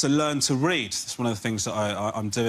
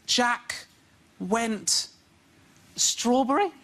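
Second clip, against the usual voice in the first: put-on higher, halting little-boy reading; child reading aloud; 4 semitones higher, much slower than usual. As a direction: guess the word like a clueless kid. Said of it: "when my son goes".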